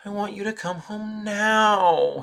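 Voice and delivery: slow, loud, high-pitched whine; whining child. A narrator's reading. Little brother whining at his sister to come home now, slow and loud. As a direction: stretch out the whine like a sulky boy. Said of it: "he whined".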